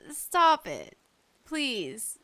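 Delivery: usual speaking voice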